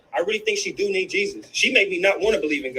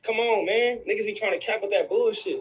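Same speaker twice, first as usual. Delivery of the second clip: ordinary talk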